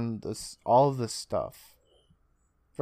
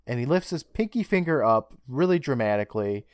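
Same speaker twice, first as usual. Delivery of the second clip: ordinary talk, nothing marked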